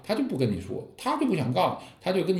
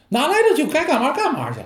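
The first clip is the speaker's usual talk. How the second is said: higher, louder brushing-off master voice; brusque master; 14 semitones higher, 5 dB louder than usual. Acted. The master sends the disciple off with a brusque, high dismissal. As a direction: wave the student off sharply, pitched up high.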